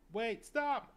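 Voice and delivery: high, very loud cry to halt; acted panicked yell; desperate shout. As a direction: yell out to stop an execution. Desperate plea at the execution.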